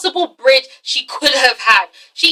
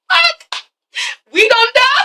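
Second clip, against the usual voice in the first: high, frantic swearing; pitched-up alarmed outburst; panicked curse; 11 semitones higher, slower than usual. Retold or imitated. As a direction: yelp it in a panic. Lionel panicking.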